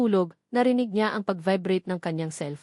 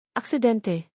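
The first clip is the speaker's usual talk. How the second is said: ordinary delivery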